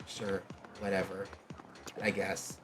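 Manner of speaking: low, quiet, downcast agreement; subdued, indifferent shrug of a voice; reluctant mumble. Acted. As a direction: give in quietly, as if too down to care. Distraught boy reluctantly agrees to partner.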